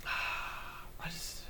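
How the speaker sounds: long unvoiced sigh; weary sigh. Real, not acted. Reviewer sighing over the story, airy and soft. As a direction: let out a tired breath.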